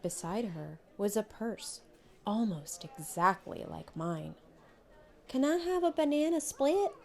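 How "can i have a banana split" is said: put-on high, pleading little girl's voice; a small girl wheedling for a treat; begging child. A narrator's reading. Little Connie begging Mama for a banana split, high and pleading. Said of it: "I begged Mama".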